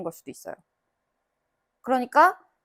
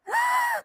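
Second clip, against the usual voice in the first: shocked gasp; 4 dB quieter than usual. Retold or imitated. Mother reacting to wrong answers.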